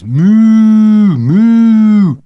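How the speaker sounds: high, loud, sustained moos; full-voiced high mooing; cried out. A narrator's reading. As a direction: bellow long moos like a taunting boy. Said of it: "cried Jeremy James".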